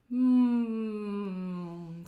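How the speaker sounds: loud buzzing hum while chewing. Shown as a demonstration. Coach humming and chewing for vibration. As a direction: hum and chew gently. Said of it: "we're gonna hum and chew".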